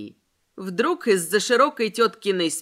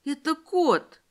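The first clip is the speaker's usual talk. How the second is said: higher-pitched, nasal excuse; flustered nasal whine; 5 semitones higher, slower than usual. A narrator's reading. Вероника blames the cat, flustered and nasal. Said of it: "растерянно прогнусавила Вероника".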